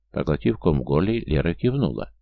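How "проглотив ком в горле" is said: usual speaking voice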